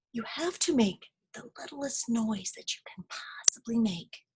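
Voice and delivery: soft whispered secret. Acted. Groundhog teaches rabbit, whispering.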